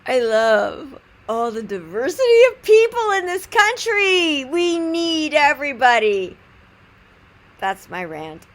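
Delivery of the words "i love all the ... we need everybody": high, loud, slow proclamation; exuberant declaration. Real, not acted. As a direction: proclaim it with drawn-out gusto. Loud declaration closing the anecdote.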